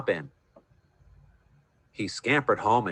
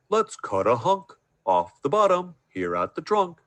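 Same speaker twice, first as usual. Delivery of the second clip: bear voice; 6 semitones higher than usual. A narrator's reading. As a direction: talk like a big bear. Bear voice again.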